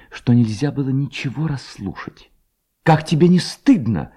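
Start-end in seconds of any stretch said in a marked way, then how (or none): 2.8-4.1 reproachful Hanna. A narrator's reading. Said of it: "сказала Ганна по окончании его речи".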